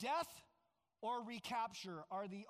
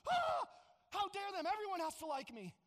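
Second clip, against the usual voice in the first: much higher, louder hammed-up outrage; mock indignation; 9 semitones higher, 5 dB louder than usual. Offended person whose pride is hurt, hammed-up indignant outburst. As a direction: play the spoiled offended ego. Mocking someone offended by dislike.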